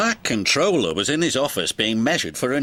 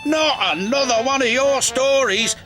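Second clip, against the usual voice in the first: higher exasperated outcry; exasperated cry; 10 semitones higher, 3 dB louder than usual. Acted. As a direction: cry out in despair at another tale. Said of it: "cried Cranky".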